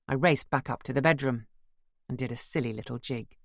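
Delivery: ordinary delivery, nothing marked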